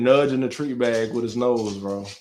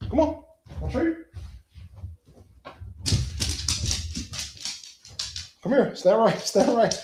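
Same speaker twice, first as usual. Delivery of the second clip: way up high, softer, teasing the dog; squeaky pet-talk commands; high-pitched dog voice; 9 semitones higher, 4 dB quieter than usual. Real, not acted. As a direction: coax a trick out of him. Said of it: "a high-pitched voice".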